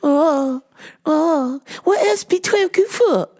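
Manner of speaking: very high mock-accented puzzled customer; high-pitched comic caricature; Miss Swan. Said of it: "Miss Swan".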